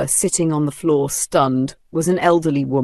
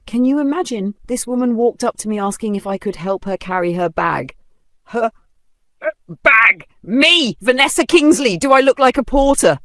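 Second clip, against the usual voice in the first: loud, high, outraged mockery; indignant rant; 7 semitones higher, 3 dB louder than usual. A narrator's reading. Vanessa mocking the old woman before the onlookers, outraged. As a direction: play to the crowd, scandalized.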